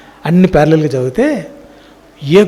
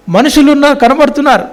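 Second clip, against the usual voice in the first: మనుషులు said much higher, in wonder; a healed blind man's amazed words, voiced high; amazed testimony; 8 semitones higher than usual. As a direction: quote the healed man with rising amazement, pitched high. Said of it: "అబ్బా, ఎంతమంది testimony!".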